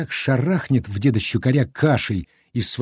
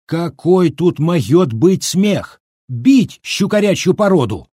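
raised, drawn-out indignant roar; fierce yell; 5 semitones higher, 4 dB louder, slower than usual. A narrator's reading. Villager outraged by the laughter, yelling fiercely. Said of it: "свирепо заорал".

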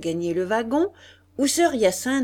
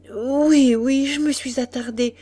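loud, higher, agitated rush of words; trembling with emotion; 5 semitones higher, 5 dB louder than usual. A narrator's reading. Old doctor bursting in to say goodbye, emotional and agitated. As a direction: blurt it out, moved and hurried. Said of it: "tremblant d'émotion".

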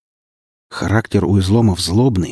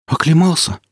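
put-on high worried young voice; nervous sidekick checking you're alive; anxious high voice; 9 semitones higher, 3 dB louder than usual. Frightened companion checks on hero.

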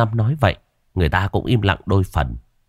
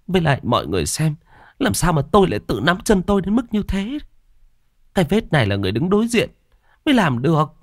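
high, protesting woman's voice; insistent frightened wife; 9 semitones higher than usual. Acted. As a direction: firmly protest to doubters, still spooked.

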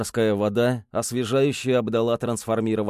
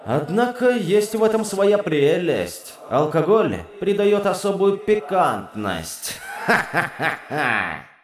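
put-on drunken demon laugh; 5 semitones higher than usual.

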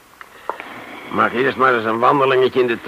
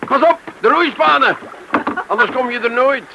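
luid roepend over het water; hard en hoog, haar waarschuwend; calling out; 9 semitones higher, 7 dB louder than usual. Acted. Kalmeert haar, roept over water. (Tom roept luid naar de vrouw in de roeiboot)

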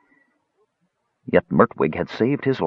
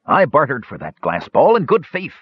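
higher-pitched indignant protest; 8 semitones higher than usual. A narrator's reading. Accused dwarf defends his trade, indignant. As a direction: protest with wounded honour, pitch jumping up. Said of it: "Mertwig said indignantly".

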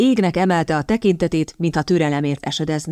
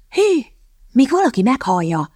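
threatening whisper; 6 semitones higher than usual. A narrator's reading. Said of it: "súgta oda Kitty fenyegetően".

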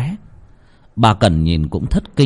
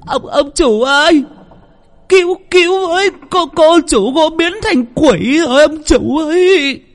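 much higher, louder panicked stuttering shriek; terrified scream; 5 dB louder than usual. A narrator's reading. Cook screams for the master after seeing the demon, shrieking. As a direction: scream for help in pure terror. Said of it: "Bà bếp hét lên".

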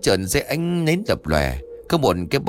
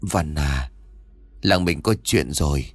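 a father tenderly talking to his lost son; 7 semitones lower than usual. A narrator's reading. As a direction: speak gently and lower, like to a beloved child. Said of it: "ông dịu dàng nói".

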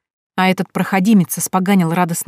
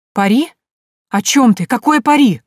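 shocked; 5 semitones higher, 6 dB louder than usual. Acted. Heroine shocked by the bet.

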